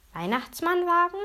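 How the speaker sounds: high, puzzled echo with rising end; disbelieving question. A narrator's reading. Boy thinks he misheard the host, disbelieving question. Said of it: "er hätte sich verhört".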